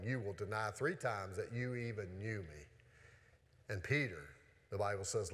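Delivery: low, soft Bible reading voice; scripture reading. A narrator's reading. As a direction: read the story softly, slowing into sorrow. Reads the denial scene aloud.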